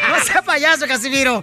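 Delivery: loud, booming holiday announcer; radio promo. Acted. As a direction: sell the greeting to the whole audience. (locutor de radio, fuerte y festivo)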